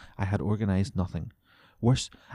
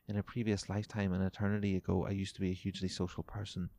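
soft read-aloud comment; 6 dB quieter than usual. Acted. Reads a reader comment aloud.

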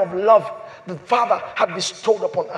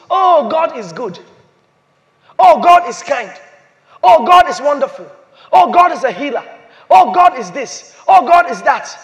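loud, higher chant-like list, "Oh" hit each time; generic praise; 3 semitones higher, 7 dB louder than usual. Acted. Preacher voicing what everybody says of God, loud generic praise. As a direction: reel off stock praises in raised voice.

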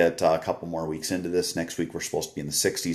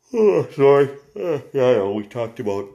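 yawning; 7 semitones higher, 8 dB louder than usual. Real, not acted. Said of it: "there's proof I yawned".

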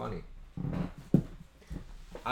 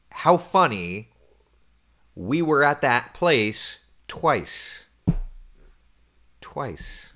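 drawn out; 4 semitones higher, much slower than usual. Real, not acted. Marvels at coincidence.

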